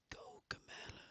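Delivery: a soft, breathy whispered aside. Real, not acted. Streamer whispers an aside to himself while playing. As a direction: breathe the word out in a private aside.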